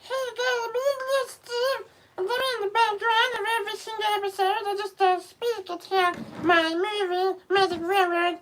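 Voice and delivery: extremely high, squeaky cartoon voice; piercing high monotone squeal; Little Steve. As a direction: squeak it all out on one high pitch. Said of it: "so he sounds".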